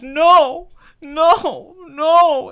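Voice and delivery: high, loud hammed-up cries; mock anguish, each word cried out; dramatic moviegoer. Mimicking the man watching Spider-Man die, dramatic. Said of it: "at this dramatic moment".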